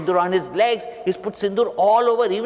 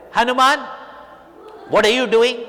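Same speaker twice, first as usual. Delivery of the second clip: raised, surprised call to Hanuman; a touch louder and higher, asking what's going on; lightly voiced questioning Ram; 6 semitones higher, 3 dB louder than usual. Ram calls out to Hanuman covered in red, questioning.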